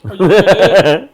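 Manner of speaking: loud, hyped question; loud mock announcer. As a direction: pump it up, loud and high. Repeating the question back.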